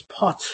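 "pot" with a strong puff of air on the final T; aspirated pot. Shown as a demonstration. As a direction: end "pot" with a sharp aspirated T. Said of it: "she says pot with a very strong lip".

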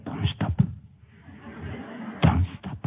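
imitated urgent stage whisper; whispered in the ear. Star urging her escort through the party, imitated whisper. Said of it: "me lo iba diciendo al oído".

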